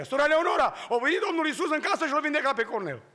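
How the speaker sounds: high, loud, fast excited shout; boy shouting to the neighbour; child calling out. Retold or imitated. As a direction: yell the good news across the house. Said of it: "și Mircea iară strigă".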